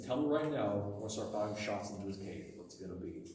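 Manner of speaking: retold threat, sinking low and soft; quietly menacing storyteller's quote; calm threat. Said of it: "Very calmly said again".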